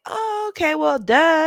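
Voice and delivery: high mocking okay, well duh; hammed-up eye-roll voice; mock dismissive listener. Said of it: "it sounds like".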